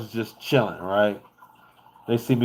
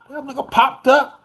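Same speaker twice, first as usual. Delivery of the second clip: ordinary talk, nothing marked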